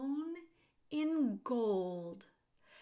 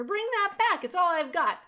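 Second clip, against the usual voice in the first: much higher, much louder plea; desperate shout; 8 semitones higher, 10 dB louder than usual. A narrator's reading. Prisoner shouts after the rat for his cloth, desperate. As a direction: scream it after the thief. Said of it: "shouted the prisoner".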